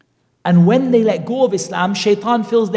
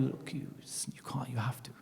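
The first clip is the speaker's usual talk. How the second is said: whisper; 5 semitones lower, 19 dB quieter than usual. Acted. Shaitan whispering temptation.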